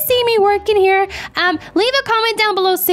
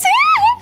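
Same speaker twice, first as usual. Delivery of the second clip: squeaky delighted giggle; high giggle. Real, not acted. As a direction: burst into a tiny giggle.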